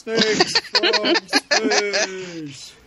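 laughing. Pretend. Echoes tagline with a laugh.

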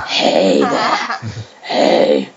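very low, calm menacing drug lord; deep menacing crime boss; Kingpin voice. Pretend. Doing Kingpin voice for email.

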